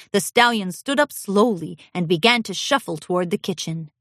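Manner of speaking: ordinary delivery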